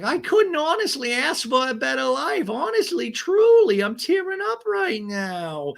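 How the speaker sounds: sing-song it high, drag the confession out; high, breathless Gina. Retold or imitated. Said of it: "Gina now has this conversation with Travis".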